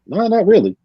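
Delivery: high, quick retold denial; mock protest. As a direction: brush it off in disbelief. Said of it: "I'm like".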